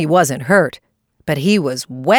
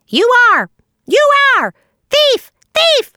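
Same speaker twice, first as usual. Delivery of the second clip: very high screeching retort; angry shriek; 4 dB louder than usual. A narrator's reading. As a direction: shriek back in short furious bursts. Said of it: "shrieked Sammy".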